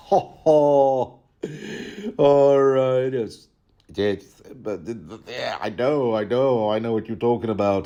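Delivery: lightly hammed-up chuckling Lothar; hearty matey laugh then talk; mock jolly Lothar. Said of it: "says Lothar".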